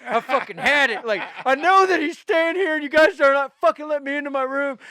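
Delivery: loud, high, furious rant at hotel staff, replayed half in jest; fuming anger. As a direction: let the anger boil over, fast at the end. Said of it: "like, just all fuming, like, angry".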